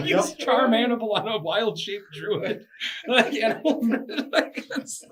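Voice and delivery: high, loud laughing talk; cracking up mid-sentence; laughing hard. Real, not acted.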